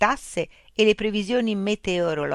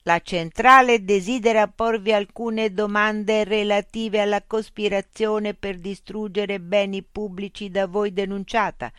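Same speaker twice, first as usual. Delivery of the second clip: machine voice; 5 semitones higher, 5 dB louder than usual. Acted. Loudspeaker robot voice addressing Mitch. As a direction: speak as a polite, mechanical information unit.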